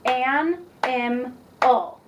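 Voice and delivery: an, im, ul said as separate beats with pauses between; clapped syllables. Shown as a demonstration. Teacher clapping out an, im, ul for the student, beat by beat. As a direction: tap out an, im, ul one beat at a time. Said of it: "I'm going to clap them out".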